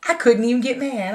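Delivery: normal talking voice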